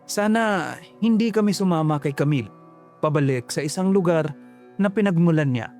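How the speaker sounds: ordinary delivery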